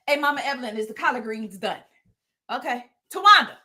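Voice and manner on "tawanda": calling out. Pretend. Calling out to Tawanda.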